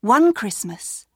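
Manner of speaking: usual speaking voice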